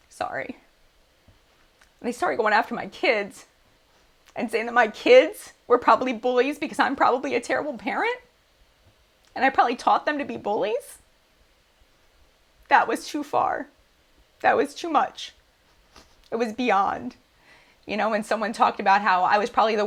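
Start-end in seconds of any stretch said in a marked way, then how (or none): 0.1-0.6 whispered through tears; a broken whispered apology; choked whisper. Real, not acted. Choking up to her viewers, a whispered sorry. Breaks down over attacks on kids. 2.0-17.2 tearful, higher voice; fighting back tears; crying. Real, not acted. Telling viewers about attacks on her kids, tearfully. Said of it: "I wasn't planning on crying".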